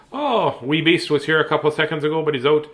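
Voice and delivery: plain reading voice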